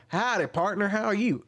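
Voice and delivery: high-pitched mock cowboy; put-on cowboy greeting. Shown as a demonstration. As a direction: hail them like a hammed-up cowpoke. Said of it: "any of this different stuff".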